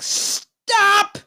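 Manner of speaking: high-pitched mock cry of protest; mock outcry. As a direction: call a halt as the whole outraged crowd. Imagined public outcry.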